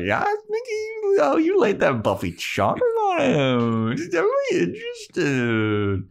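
very high squealing put-on voice; gushing Xander. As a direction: squeal like an excited gossip. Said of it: "Xander's like".

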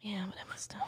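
whispering. Real, not acted. Conceding about Emma Stone.

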